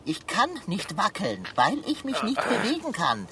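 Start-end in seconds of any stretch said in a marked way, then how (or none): none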